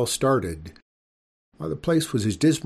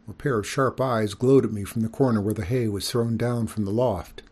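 plain reading voice

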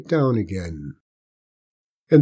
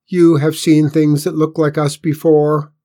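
demanding alien voice; 5 semitones higher, 7 dB louder than usual. A narrator's reading. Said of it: "the alien leader demanded".

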